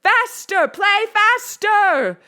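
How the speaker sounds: high, loud, slow command; ordering shout. A narrator's reading. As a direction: bellow the order. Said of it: "Doctor Shriek ordered".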